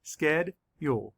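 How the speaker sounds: slow, each syllable pulled apart. Shown as a demonstration. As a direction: sound out each syllable slowly. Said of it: "Let's count the sch-, syllables".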